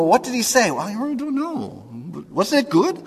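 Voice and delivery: high-pitched, hammed-up mock bafflement; puzzled churchgoers. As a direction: play clueless listeners, voice way up. Acts out puzzled churchgoers in car.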